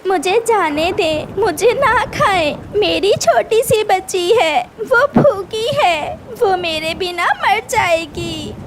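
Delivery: high, pleading plea for life; desperate mother bird pleading; begging. Acted. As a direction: beg the eagle to spare her. Bird begs eagle for life.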